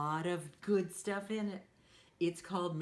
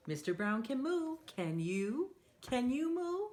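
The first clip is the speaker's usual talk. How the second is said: put-on reading to children; 6 semitones higher than usual. Reading a picture book to children.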